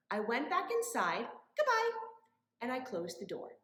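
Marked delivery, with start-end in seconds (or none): 1.6-1.9 cheery goodbye. Acted.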